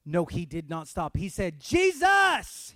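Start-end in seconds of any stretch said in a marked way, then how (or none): 1.6-2.7 high, loud cry for attention; yelling. Acted. Blind Bartimaeus calling out to Jesus, yelling. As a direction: yell the name with everything you have. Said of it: "He yelled it".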